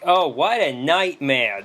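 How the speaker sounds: slow, drawn-out Brooklyn whine; Brooklyn accent. Retold or imitated. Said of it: "in thick Brooklyn accents".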